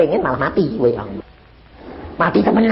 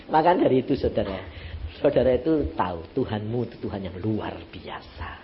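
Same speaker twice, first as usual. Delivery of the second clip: normal talking voice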